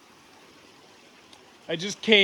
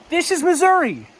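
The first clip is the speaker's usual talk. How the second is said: much higher, a touch louder, a sure falling answer; awed exclamation; 9 semitones higher, 3 dB louder than usual. Real, not acted. Hiker answers his own question, awed and sure. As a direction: answer yourself in delighted awe.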